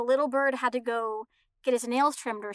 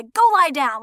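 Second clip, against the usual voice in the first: stern command; 2 semitones higher, 7 dB louder than usual. Retold or imitated. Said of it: "they'd say".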